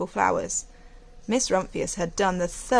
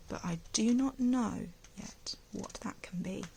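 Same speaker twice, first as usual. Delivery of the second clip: whisper; 8 dB quieter than usual. Retold or imitated. Alice answers, retold to class.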